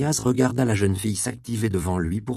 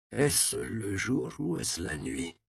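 put-on groggy old man; 2 semitones higher, 7 dB quieter, slower than usual. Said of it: "demanda-t-il en grimaçant".

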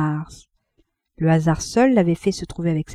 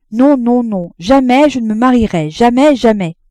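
louder, fervent refusal; insistent repeating; 4 semitones higher, 6 dB louder than usual. A narrator's reading. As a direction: swear it again and again. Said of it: "en répétant". (plus fort, répété avec ferveur)

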